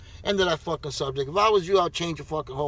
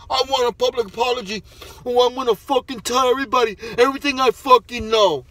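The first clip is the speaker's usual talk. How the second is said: sneering imitation of a sulky champion, louder; mock whiny demand; 4 semitones higher, 6 dB louder than usual. Ranting at a fighter, mocking his apology demand in a sneering voice. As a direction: parody his demand like a spoiled kid.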